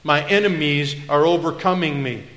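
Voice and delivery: impassioned, unvarnished lament at full volume; loud complaint. Acted. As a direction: cry out to God in raw protest. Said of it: "He complains to God".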